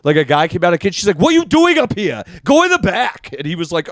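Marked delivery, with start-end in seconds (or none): 1.2-3.3 high-pitched imitation of a bossy scolding; yelling shop woman. Donut shop woman to her staff, yelling. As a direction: mimic her ordering staff around, pitched way up. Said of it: "she yelled at her staff".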